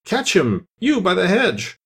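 a hint of a high, urgent master; Rabbit's command. A narrator's reading. The Rabbit ordering someone to catch Bill, urgent. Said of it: "Then the rabbit's voice along".

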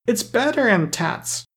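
put-on high, slow baby-talk voice; little girl's lisping pitch, pleased; delighted toddler. A narrator's reading. Mary compares the doll with her broken toy, delighted. Delighted child answers Claus.

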